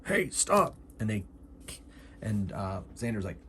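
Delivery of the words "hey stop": high-pitched calling out as a guard; acted guard yelling to halt; guard's challenge. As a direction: challenge an intruder from a distance. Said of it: "The guard goes".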